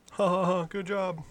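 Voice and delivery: high, hollow polite laugh, then a limp good job; mock sympathy laugh. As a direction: fake a pity chuckle way up high. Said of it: "sympathy laughs".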